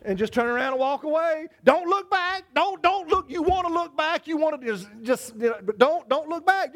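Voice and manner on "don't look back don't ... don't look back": high, pressing plea; insistent high warning; urging not to look. Acted. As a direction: push hard, don't turn round. Preacher acting out temptation to look.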